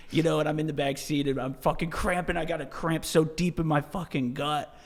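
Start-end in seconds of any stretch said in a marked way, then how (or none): none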